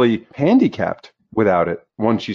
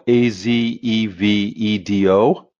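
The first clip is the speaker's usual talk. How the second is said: slow, letter by letter; spelling it out; much slower than usual. Shown as a demonstration. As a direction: spell out each letter slowly. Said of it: "his last name is spelled".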